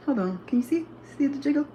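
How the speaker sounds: ordinary talk, nothing marked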